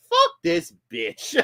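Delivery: high, slow mock voice; playful high impression of her; imitating Kate. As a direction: voice her as a squeaky smug figure. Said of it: "She's like".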